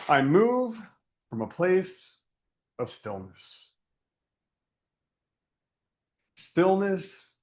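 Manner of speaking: measured invocation with long pauses; guided meditation. Acted. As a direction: guide listeners gently into stillness, letting phrase endings sink.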